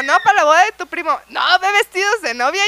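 retold insistence. Couple arguing over which dresses to look at, loud insistence. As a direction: act out a rising back-and-forth insistence.

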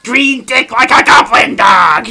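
loud, high, slow shouted goblin voice; hammed-up screaming Green Goblin; Willem Dafoe goblin. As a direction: roar it like a crazed goblin. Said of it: "he goes".

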